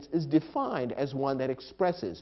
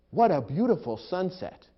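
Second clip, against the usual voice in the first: high, louder burst of admiration; admiring exclamation; 7 semitones higher, 5 dB louder than usual. Shown as a demonstration. Reflecting on the beauty of nature, admiring exclamation. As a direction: exclaim with positive strong emotion. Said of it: "because strong emotion is involved".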